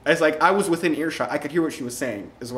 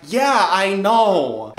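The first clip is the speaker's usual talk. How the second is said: put-on very slow, louder, high girly drawl; Sam agreeing; 4 semitones higher, 6 dB louder, much slower than usual. Sam agreeing he's ugly, slow high drawl. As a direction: stretch it out like a bored popular girl.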